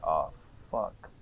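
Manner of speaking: low, quiet, emptied-out curse; hollow dread. Retold or imitated. Sinking realisation at his newborn, quiet and low. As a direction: let it drop out of you, hollow. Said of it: "I felt this emptiness".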